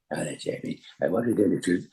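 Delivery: quiet impression of the old Doctor; Patrick Troughton voice. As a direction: slip into the old Doctor's hushed tones. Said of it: "I started to do impression of, of Patrick".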